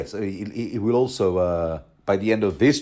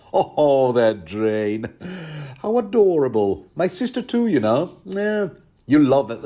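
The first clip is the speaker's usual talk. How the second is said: chummy, over-friendly matey voice; jolly Lothar Frey. Retold or imitated. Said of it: "He's all like".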